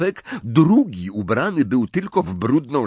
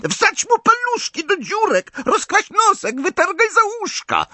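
very high, gleeful poltergeist shriek; shrieking taunt. A narrator's reading. Poltergeist circling the lamp, shrieking at the elves. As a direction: scream it with nasty glee. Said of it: "wrzeszcząc".

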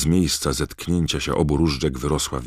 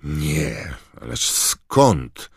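slow, flustered frightened denial; stammered in fright; 3 semitones higher, 5 dB louder, much slower than usual. A narrator's reading. Amos, terrified, denies accusing Crouch, stammering. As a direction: back off fast, scared. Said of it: "wyjąkał Amos wyraźnie przerażony".

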